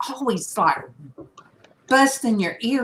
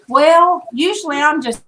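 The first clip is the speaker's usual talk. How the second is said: raised, higher voice imitating a loud recording; loud playback; 6 semitones higher, 6 dB louder than usual. Coworker retelling a recording played aloud at work, loud. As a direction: re-enact it blaring out, loud and high. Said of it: "it was so loud".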